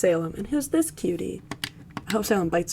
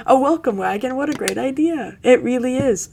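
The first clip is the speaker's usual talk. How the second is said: higher and louder, thrilled gush; gushing Crystal; 5 semitones higher, 6 dB louder than usual. Acted. Crystal praises the welcome wagon idea with loud high excitement. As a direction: gush over the idea like it's the best thing ever.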